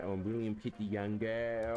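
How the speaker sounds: drawn-out mock-English statesman. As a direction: stretch it out like a pompous prime minister. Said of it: "Australian Prime Ministers back then used to cosplay".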